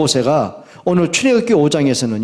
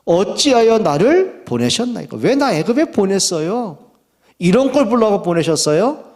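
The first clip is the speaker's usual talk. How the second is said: higher-pitched aggrieved questioning; protesting; 6 semitones higher than usual. Acted. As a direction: protest to God as if wronged. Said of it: "이렇게 항변을 해요". (항변하는 말투)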